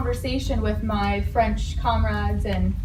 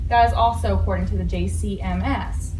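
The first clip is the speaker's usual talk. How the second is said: usual speaking voice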